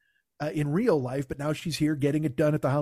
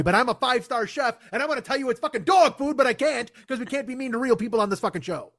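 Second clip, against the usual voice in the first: raised, higher-pitched mock rant; exasperated outburst; 4 semitones higher than usual. Chef judging real people's chili, exasperated outburst. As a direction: let the judge's pent-up honesty burst out. Judge's suppressed true opinion bursts out.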